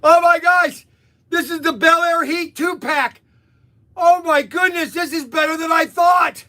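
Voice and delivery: high-pitched, shouted delight; thrilled exclamation. Real, not acted. Vlogger revealing the two-pack, thrilled and exclaiming. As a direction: cry out as if it beats every hope.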